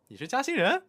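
high laughing local driver; mocking driver, high and laughing; taxi driver voice.

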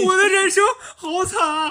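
high, hammed-up crying lament; fake-sob over his own bad luck; mock wail. (假哭：高声夸张的哀叹)

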